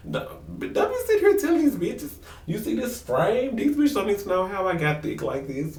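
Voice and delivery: high-pitched, hammed-up scolding; mock indignation. Spouse protesting the oversharing to Kirk, high mock indignation. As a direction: act out an affronted spouse, voice up high.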